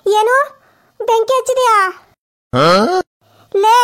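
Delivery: high, loud disbelieving ask; can't believe she lit a fire; aghast mother-in-law; shocked question. Acted.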